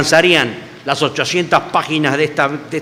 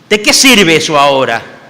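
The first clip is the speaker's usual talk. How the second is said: louder, pained outburst; loud bitter question; 6 dB louder than usual. Retold or imitated. Peter cries out to the Lord what use it is now. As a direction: throw the question out in frustration. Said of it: "imagino que Pedro le diría".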